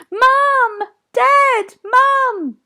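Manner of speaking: very high, loud shouts; frantic calling. Acted. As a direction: yell out, scared and desperate. Calling in trapped cave.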